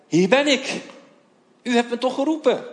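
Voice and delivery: put-on a child answering his master's call, keen; young boy Samuel. Jonge Samuel rent naar Eli. (gretige jongensstem, hoger)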